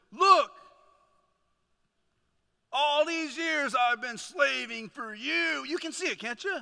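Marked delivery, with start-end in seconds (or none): 0.1-0.5 sharp, high shout; angry older brother. Acted. Older son confronting his father, angry shout. He says this. 2.7-5.6 loud, slow, aggrieved protest; angry older brother. Acted. Resentful son confronting his father, loud and angry.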